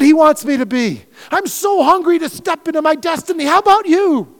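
high, loud, fiery preaching; full-force preaching. Acted. Pastor driving his point home, preaching at full force. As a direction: preach it hard to the church.

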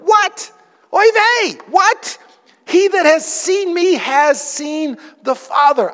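put-on high, exasperated Yiddish-accented Jesus. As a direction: throw up your hands, Yiddish accent. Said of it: "And Jesus is like".

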